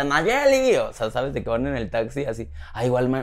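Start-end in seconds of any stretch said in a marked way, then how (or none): 0.0-0.7 much higher, louder call of the name across the street; fan shouting her name. Retold or imitated. People in the street shouting at her, a loud high call of her name. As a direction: imitate a fan calling out from afar. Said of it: "la gente me ve y me grita".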